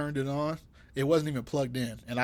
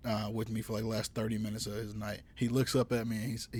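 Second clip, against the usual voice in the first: ordinary talk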